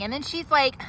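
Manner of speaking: ordinary delivery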